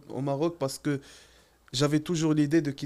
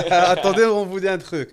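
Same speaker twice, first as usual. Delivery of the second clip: excited laugh; 4 semitones higher, 8 dB louder than usual. Real, not acted.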